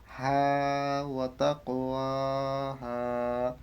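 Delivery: slow, drawn-out হা ওয়া তাকওয়াহা; careful Quranic recitation; slow recitation restart. Shown as a demonstration. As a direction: restart from হা, unhurried. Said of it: "হা থেকে আবার শুরু করবে".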